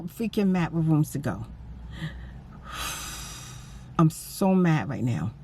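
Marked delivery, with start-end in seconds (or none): 2.7-3.3 long unvoiced sigh of frustration; heavy sigh. Real, not acted. Still upset after the corporate call, a heavy sigh. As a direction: let out a tired breath.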